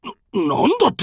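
高く速くどもる驚きの声; 焦って思わず聞き返せ; 慌てた驚き. Acted. 悪い知らせに驚く頭目. (娘が危ないと知った頭目、慌てた高い声)

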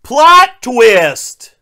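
loud, high comic shout; exaggerated shouted exclamation; mock dramatic announcement. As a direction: belt it out like a huge reveal. Mock announcement of story turn.

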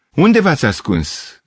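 high, drawn-out call. A narrator's reading. Gavrilescu searching for the hidden girls, calling out. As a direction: call slowly after people in hiding. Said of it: "strigă din nou".

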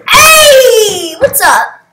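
loud, high, long-held call; big excited shout of greeting. Real, not acted. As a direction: holler hello across the game. Greets player loudly.

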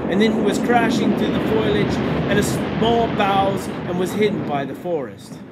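ordinary delivery, nothing marked

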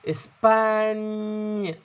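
"إسباني" stretched high and slow into a long ny; drawn-out syllable demo. Shown as a demonstration. Teacher making students notice the last syllable, stretched high. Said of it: "لاحظوا المقطع هذا".

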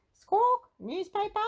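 high squawk, then parroted words; loud squawk easing into high echo; copying parrot. A narrator's reading. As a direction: repeat the order like a parrot. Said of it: "Polly Parrot copied".

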